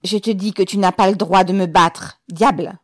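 loud, angry insistence; loud enraged protest; furious voice. A narrator's reading. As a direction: hurl it at him in fury. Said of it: "La voix furieuse de Ribine répéta".

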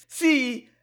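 high, slow, loud wail breaking into sobs; hysterical sobbing cry. A narrator's reading. Zina to her mother, sobbing in terror. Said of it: "paroxysme de cris et de pleurs nerveux".

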